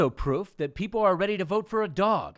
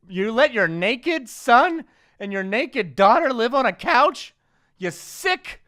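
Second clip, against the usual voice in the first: hammed-up scolding, pitched high; loud, high incredulous telling-off; mock outrage; 5 semitones higher, 6 dB louder, slower than usual. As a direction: berate a parent in mock disbelief. Mock scolding of a naked-child parent.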